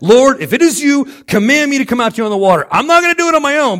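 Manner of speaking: loud, high-pitched shout to Jesus; calling out. Acted. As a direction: cry out over the storm, urgently. Preacher acts Peter calling out.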